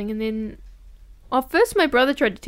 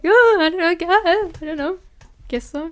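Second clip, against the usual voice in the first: imitating herself; 6 semitones higher, 6 dB louder than usual.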